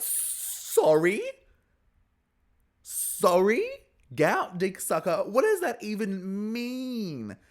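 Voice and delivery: high, loud, drawn-out mock shock; incredulous. Recapper baffled by a bizarre insult, loudly incredulous. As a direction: question it as if you can't believe your ears.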